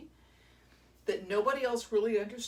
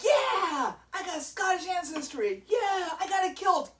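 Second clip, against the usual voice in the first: higher, louder over-eager tourist; mock excited American; 8 semitones higher, 6 dB louder than usual. Kilt seller imitating over-eager American visitors. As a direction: gush like a clueless fan. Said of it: "and you're all like".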